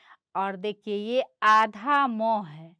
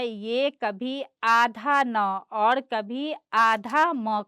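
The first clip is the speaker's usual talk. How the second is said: slow teaching; 4 dB louder, much slower than usual. Shown as a demonstration. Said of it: "अनुस्वार".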